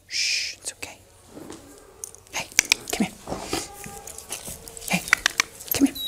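hushed, calming, barely voiced; soothing whisper. Real, not acted. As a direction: whisper softly to a frightened dog. Soothing the scared dog.

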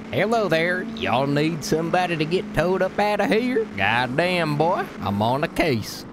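high, drawn-out Southern twang; Southern accent. Shown as a demonstration. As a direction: pitch up into a big Southern accent. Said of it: "I could do a southern accent maybe".

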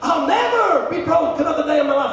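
high, loud preacher's shout. Retold or imitated. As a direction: imitate a preacher declaring it with force. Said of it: "I look at the preacher saying".

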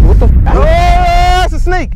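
high slow startled cry; startled shout. Real, not acted. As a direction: cry out in shock at a snake. Sees a snake.

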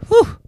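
high loud relieved whistle-breath; exaggerated sigh of relief; relieved whew. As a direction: blow out relief. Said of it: "you're relieved".

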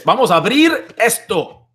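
loud, high-pitched Spanish hype call; hyped Spanish announcement. Pretend. Host hyping the unboxing in Spanish, loud and high. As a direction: shout it like a rallying cry, in Spanish.

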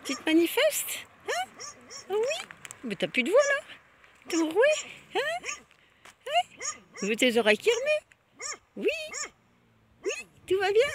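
high, loud pet-talk voice; high-pitched calling out to a guard dog. Real, not acted. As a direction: squeak up at the dog to win it over. Coaxing the barking dog.

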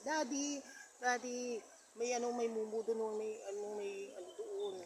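put-on high-pitched scared child calling for daddy; retold child's scream. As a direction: cry out for daddy in a small frightened voice. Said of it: "sumigaw daw yung ano". (mataas at takot na sigaw ng bata)

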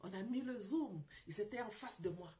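ordinary talk, nothing marked

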